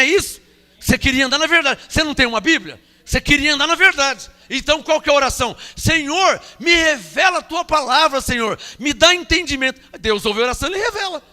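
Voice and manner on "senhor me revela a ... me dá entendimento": crying out in prayer. Retold or imitated. Believer crying out to God for revelation, raised and urgent.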